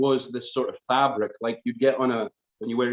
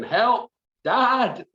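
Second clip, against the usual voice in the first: high, raised cry for dad; retold shout for help; 12 semitones higher, 4 dB louder than usual. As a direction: call out for rescue in mock panic. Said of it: "I was shouting".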